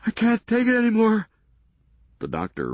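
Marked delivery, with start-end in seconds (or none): 0.0-1.3 high, loud wail of despair; desperate cry. Acted. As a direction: let it break out of him, at the end of his rope. Doug breaking down in panic.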